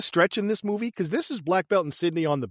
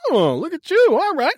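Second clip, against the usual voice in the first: 1 mock impressed heroine; 7 semitones higher, 4 dB louder than usual. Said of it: "she's like".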